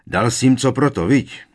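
high, loud, jovial prompting; blustering proud father; loud and hearty. A narrator's reading. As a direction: egg him on boomingly. Uncle heartily prompting his son.

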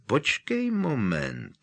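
slow, drawn-out wait; slowly. Acted. Ron recognizes the silver goblet, slowly. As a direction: hold it back, slowly. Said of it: "řekl pomalu".

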